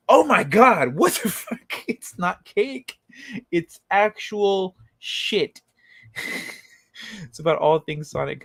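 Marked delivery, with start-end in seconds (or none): 0.1-1.8 louder, higher burst of real shock; shocked outburst. Real, not acted. Streamer reacting to the cake reveal, shocked. 1.9-5.6 slowed, higher, leaning on cake; slow deadpan punchline. Real, not acted. Streamer telling chat about the cake, slow deadpan.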